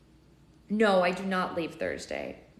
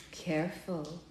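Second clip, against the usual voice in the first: warning; 2 semitones lower, 8 dB quieter, much slower than usual. A narrator's reading. Enchantress cautioning bold Charlotte, quiet warning. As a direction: warn her quietly. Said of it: "Esmea warned her".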